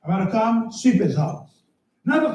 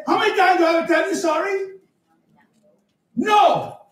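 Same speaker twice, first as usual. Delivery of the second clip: exasperated; 7 semitones higher than usual. Retold or imitated. Exasperated repeated apology.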